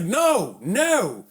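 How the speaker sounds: high, forceful police command; retold stern cop. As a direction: imitate an officer warning someone off. Cop ordering him back, retold.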